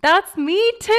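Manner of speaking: loud, high-pitched delighted outburst; real burst of excitement; excited agreement. Real, not acted. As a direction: jump in loudly, thrilled to relate. Excited at sharing the same experience.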